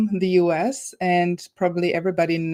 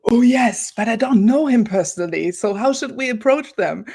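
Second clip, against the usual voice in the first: higher, louder retold reaction after a gasp; eager exclamation; 4 semitones higher, 3 dB louder than usual. Host retelling her reply when Maurice was suggested, eager exclamation. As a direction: relive an eager "oh yes", then the worry. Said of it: "And I said".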